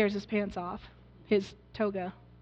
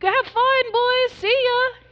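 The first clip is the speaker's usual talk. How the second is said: much higher, louder, cheery send-off; cheerful farewell call; 11 semitones higher, 5 dB louder, slower than usual. Acted. Zebedee calling cheerfully after his departing sons, much higher. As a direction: wave the boys off with glee. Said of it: "can you hear the cries of Zebedee".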